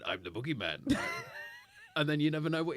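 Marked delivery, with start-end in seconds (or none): 0.0-1.0 lightly voiced spooky wrestler intro; a hint of a menacing character voice; The Boogeyman. Guest imitating the wrestler's entrance track, lightly menacing. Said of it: "with his voice going".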